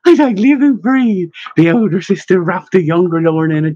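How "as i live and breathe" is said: high-pitched joyful amazement; ecstatic. A narrator's reading. Elder sister embracing the younger Lorna, ecstatic. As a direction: exclaim in delight, voice lifted high. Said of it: "Sister Bryce was ecstatic".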